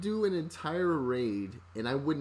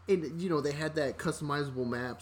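ordinary talk

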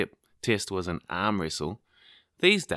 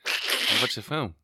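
mock whiny friend; 6 semitones higher, 6 dB louder than usual. Mocking friends at the movies.

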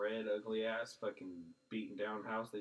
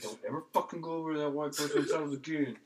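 lightly voiced scolding father, a bit higher; mock stern father; 6 semitones higher, 8 dB louder than usual. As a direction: play the warning dad half-joking. Neighbor's father scolds kids.

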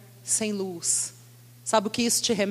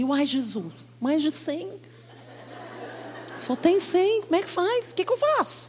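worried plea; 7 semitones higher than usual. Retold or imitated. Panicked prayer about price.

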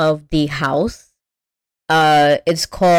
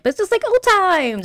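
pleading Velma; 12 semitones higher than usual. Retold or imitated. Podcaster voices Velma coaxing Daphne, high and pleading. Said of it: "Velma being like".

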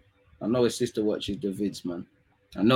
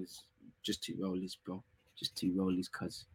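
much softer, lower mutter to himself; muttered aside; 5 semitones lower, 14 dB quieter than usual. Real, not acted. Repeating his wish to himself, dropping to a murmur. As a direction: trail off under his breath.

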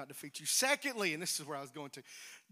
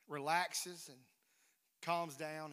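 ordinary talk

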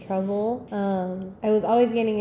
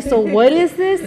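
loud question; 5 semitones higher, 9 dB louder than usual. Retold or imitated. Boyfriend asks loudly.

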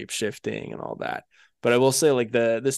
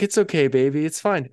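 lightly voiced consoling girlfriend, higher; mock reassurance, pitched up and louder; mock soothing; 5 semitones higher, 4 dB louder than usual. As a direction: soothe him with exaggerated indulgence. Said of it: "And then she's like".

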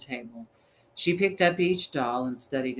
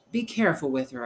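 ordinary delivery, nothing marked

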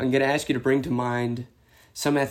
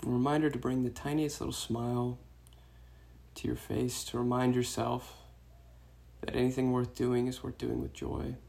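soft meditation voice with long pauses; guided meditation; 8 dB quieter than usual. Real, not acted. Meditation teacher guiding runners to release tension, soft guided-meditation voice. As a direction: guide them gently into the breath, hushed and unhurried.

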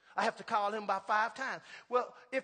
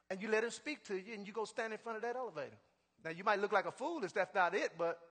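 usual speaking voice

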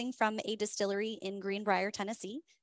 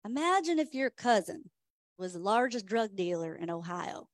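put-on horrible Charlie Nelson accent; 4 dB louder than usual. Host retelling Charlie's joke to the tasting crowd, in a bad Charlie Nelson accent. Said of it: "I do a horrible Charlie Nelson accent".

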